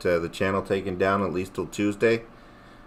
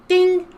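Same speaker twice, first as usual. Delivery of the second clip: high mock ding of a phone; notification ding; 4 dB louder than usual. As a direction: chime it like an alert, sharply higher. Imitating a notification sound.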